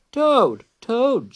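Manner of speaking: high, sing-out call to a friend; high storybook shout. A narrator's reading. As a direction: call out high, falling on each name. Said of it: "shouted Frog".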